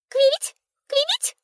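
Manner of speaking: very high bright bird chirping. A narrator's reading. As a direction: chirp it like a swallow. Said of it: "прочирикала птичка". (птичье щебетание)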